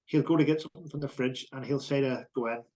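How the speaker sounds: usual speaking voice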